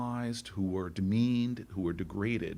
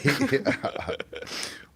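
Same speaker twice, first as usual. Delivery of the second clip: short airy laugh before the joke; breathy laugh; 6 semitones higher, 5 dB louder than usual. Real, not acted. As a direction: laugh it off, amused. Senator laughs before telling joke.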